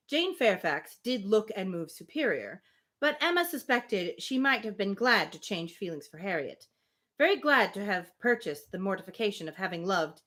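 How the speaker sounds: steady, measured reading of the novel; story narration. Acted. Reading Emma aloud to a friend, measured narration. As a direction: read the Austen passage aloud, even and clear.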